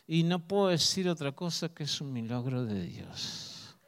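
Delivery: low, hushed reluctant admission imitating a doctor; through clenched teeth. Doctor admits a miracle to the mother-in-law, through clenched teeth. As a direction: grudgingly concede it, voice dropped and teeth clenched. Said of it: "con los dientes apretados".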